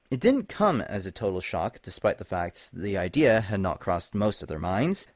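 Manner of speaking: ordinary delivery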